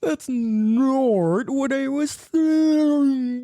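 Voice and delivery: high slow drawn-out put-on voice; hammed-up slow silly voice; mock slow voice. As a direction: drag it out in a silly voice.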